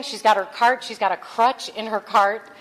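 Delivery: ordinary talk, nothing marked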